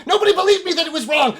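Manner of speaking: high, loud, rushed shriek; frantic mad ranting; insane elf. Acted. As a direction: race through it in a crazed shout. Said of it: "fucking insane elf".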